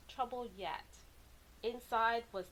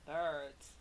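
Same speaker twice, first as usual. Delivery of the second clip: low answer; 7 semitones lower than usual. Acted. Answer to own riddle.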